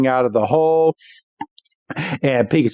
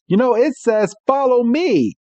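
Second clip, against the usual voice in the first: Meowth voice; 9 semitones higher than usual. Retold or imitated. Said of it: "Meowth is like".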